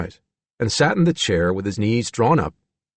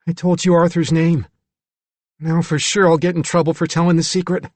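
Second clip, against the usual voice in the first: put-on slightly higher, worried boy; frightened child figure; 7 semitones higher, 4 dB louder than usual. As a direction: fret like a child who let a secret slip.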